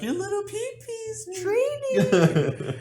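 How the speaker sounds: very high, slow, laughing mock voice; mocking captor voice. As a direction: taunt him in a silly squeaky voice.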